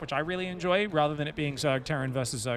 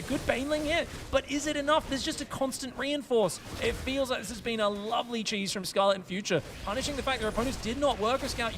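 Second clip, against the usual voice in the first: higher, energetic caster voice calling the fight; live play-by-play; 5 semitones higher than usual. Acted. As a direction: call the action live, pushing the energy.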